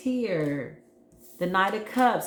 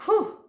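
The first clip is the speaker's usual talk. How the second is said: whew; 4 dB louder than usual. Real, not acted. Overwhelmed by good reading energy.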